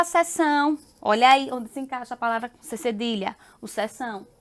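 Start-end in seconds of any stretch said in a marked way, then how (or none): none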